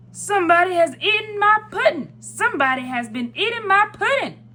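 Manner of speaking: great big bear voice, loud and high-pitched; Papa Bear. A narrator's reading. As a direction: boom it out like an outraged big bear. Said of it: "in his great big voice".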